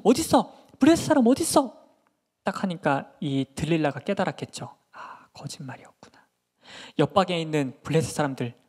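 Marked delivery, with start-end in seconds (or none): 0.0-1.8 loud, high challenge; acted fired-up bellowing; combative shout. Samson hunts for the attackers. 4.9-6.2 hushed aside to oneself; very soft, breath-only murmur; whispered realisation. Acted. Delilah silently realises the lie.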